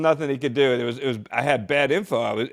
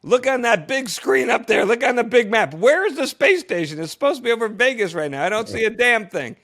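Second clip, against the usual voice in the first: higher and louder, urgent demand to the flight director; frantic plea; 11 semitones higher, 6 dB louder than usual. Retold or imitated. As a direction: beg Houston for help, all worked up. Frantic call to Mission Control.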